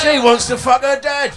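loud and high, cracking up; laughing shout. Real, not acted. Teasing friend, shouting the joke at her.

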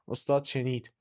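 plain reading voice

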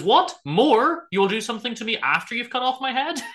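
high, acted disbelief in a retold courtroom; incredulous mock outrage. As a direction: play it as a baffled prisoner pushing back. Accused mocks judge in retold scene.

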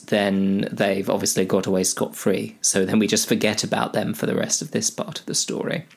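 usual speaking voice

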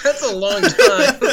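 high, slow, laughing through it; laughing disbelief. Retold or imitated. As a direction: balk at forever while laughing. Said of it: "I was like".

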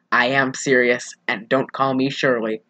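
flat-faced comic deadpan; lightly voiced Leslie Nielsen, low and dry. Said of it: "And Leslie Nielsen said".

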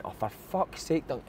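slow, soft exasperated groan of words; retold exasperation. Left back to centre forward who lost the ball, exasperated. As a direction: drag it out, quietly exasperated.

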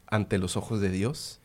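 a hint of a soft, sweet priest; softly imitating the priest blessing the couple. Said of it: "me lo imagino con esa voz".

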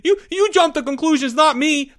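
very high, stammering comeback; squealed retort. Acted. Porge throwing blame back at Leo, squealed retort. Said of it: "Porge shot back".

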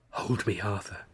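soft breathy plea; breathed. Acted. Wren pulls Arthur into a dark recess, breathy and soft. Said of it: "she breathed".

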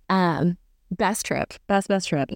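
usual speaking voice

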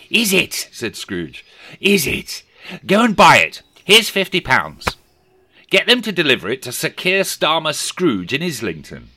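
high, loud, gleeful orders; scheming Scrooge. A narrator's reading. Scrooge sending the boy to buy the turkey, gleeful and loud. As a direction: bark commands then a gloating laugh.

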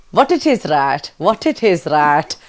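Yorkshire-Pakistani catchphrase in the Kaniz voice; Kaniz's Yorkshire-Pakistani accent. Shown as a demonstration. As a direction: give the phrase a Yorkshire-Pakistani twang. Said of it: "Yeah, I did actually".

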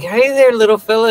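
high sweet greeting; mock sweet woman. Cindy greeting the parrot, high sweet voice. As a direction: coo it at the parrot. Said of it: "And she's like".

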